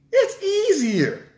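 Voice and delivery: sarcastic high-pitched drawn-out mockery; mock high falsetto. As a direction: stretch it out high like a mocking excuse. Mocking the excuse people make.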